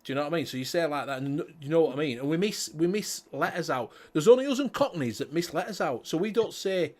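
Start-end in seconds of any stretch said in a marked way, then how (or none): none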